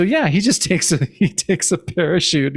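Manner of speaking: usual speaking voice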